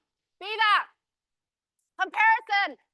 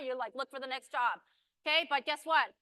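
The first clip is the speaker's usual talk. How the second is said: ordinary talk, nothing marked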